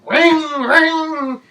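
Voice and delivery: high mock drill ringing; playful drill sound effect; drill noise.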